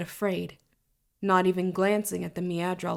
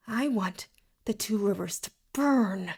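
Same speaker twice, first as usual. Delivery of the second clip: held-back fury, broken into short phrases; seething menace. A narrator's reading. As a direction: seethe just before exploding. Villain seething before outburst.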